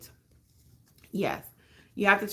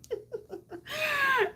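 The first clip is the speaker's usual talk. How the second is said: high laugh; 4 dB quieter than usual. Real, not acted.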